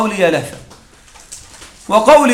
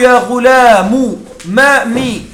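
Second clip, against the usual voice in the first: يا غلام drawn out as "ya ghulamu"; very slow "ya ghulamu" for يا غلام; slow demo; 3 semitones higher, 3 dB louder, much slower than usual. Shown as a demonstration. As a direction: stretch يا غلام slowly for the class. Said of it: "وتقول".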